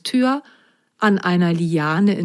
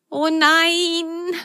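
much higher, a touch of whining; a small sock lightly wailing; whining little sock; 11 semitones higher than usual. Acted. Lu stuck on the vine, high mild whine. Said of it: "jammerte Lu".

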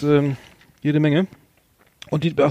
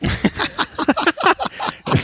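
high giggle. Real, not acted. Laughing at own category.

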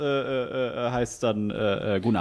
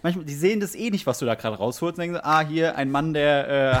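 ordinary talk, nothing marked

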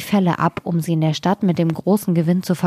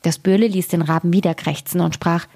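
ordinary delivery, nothing marked

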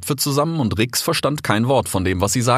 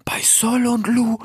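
shocked whisper; slower than usual. A narrator's reading. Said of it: "flüsterte Rix geschockt".

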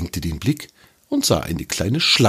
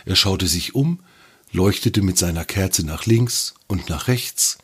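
plain reading voice

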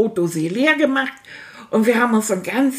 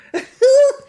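a sudden squealing high laugh; high burst of laughter; 14 semitones higher, 7 dB louder than usual. Acted. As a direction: burst out in a high, loud giggle. Sudden burst mid-quote.

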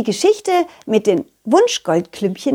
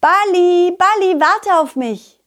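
calling out; 8 semitones higher, slower than usual. Acted. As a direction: call out to a ball rolling away. Calling after the rolling ball.

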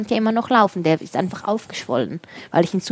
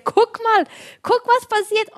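re-enacted amazed cry, much higher pitch; excited calling out; 13 semitones higher than usual. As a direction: call out in high-pitched excitement, "look at this!". Ruft begeistert nach plötzlicher Heilung. (aufgeregtes, hohes Rufen, staunend nachgespielt)

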